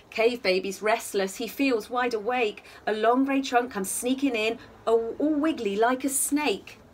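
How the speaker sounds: gentle sing-song bedtime reading; cosy read-aloud storytelling; story narration. A narrator's reading. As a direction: read it like a rhyming picture book for little ones. Reading picture book aloud.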